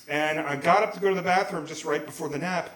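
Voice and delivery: normal talking voice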